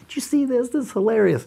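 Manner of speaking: gleeful look at this; excited. Pretend. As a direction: share a funny find with delight. Showing daughter funny book.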